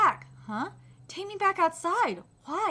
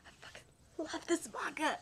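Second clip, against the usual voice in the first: soft breathless whisper; soft whispered confession; 12 dB quieter than usual. Real, not acted. Secret confession to viewers.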